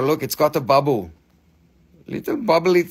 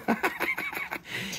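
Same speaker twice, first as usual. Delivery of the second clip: high, soft giggle; laughing; 11 dB quieter than usual. Real, not acted. Seller cracks up at dog pee snowman joke, laughing. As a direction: giggle at your own silly joke.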